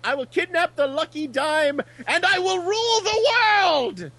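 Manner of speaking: put-on hammy Natasha Fatale accent, rising high and slowing at the end; cartoon villainess in a Natasha Fatale accent, building to a gloat; megalomaniac rant. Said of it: "in her June foray Natasha Fatale voice going".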